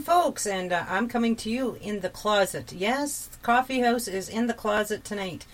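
usual speaking voice

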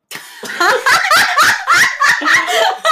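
loud, high belly laugh; real high-pitched cackling laugh; burst of laughter. Real, not acted. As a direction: crack up loudly and helplessly.

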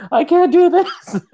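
high, slow, whimpering grown man, hammed up; mock crying grown man. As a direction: wail it like a big man about to cry. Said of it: "crying like a fucking baby".

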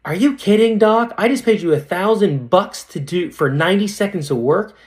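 high, indignant retelling of a complaint; mad patient. Retold or imitated. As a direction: act out an outraged customer. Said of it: "and she was mad".

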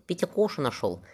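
plain reading voice

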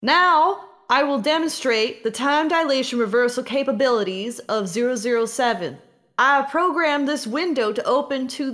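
loud, high shout over a roaring machine; shouting over machinery. Acted. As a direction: yell to be heard over the noise. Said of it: "She has to yell over the roar of the machine".

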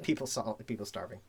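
low, quiet deadpan; deadpan mutter. Acted. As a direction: drop it flat and low.

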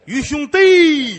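high, loud, very slow call; drawn-out calling. Acted. Old man hailing his benefactor, long loud crisp call. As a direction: stretch the name out in a long crisp cry. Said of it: "越叫越脆上".

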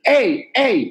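"Ey, ey" called high with lips wide open; "Ey, ey" in a high, open-mouthed shape; open-lipped demo. Shown as a demonstration. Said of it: "más de abrir así".

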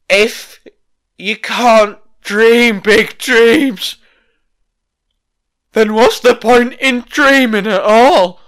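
slow, hammed-up inspirational promo; mocking promo voice. Vlogger mocking a wrestler's cringey promo, hammed-up and slow. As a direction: lampoon a cheesy wrestling speech, dragged out.

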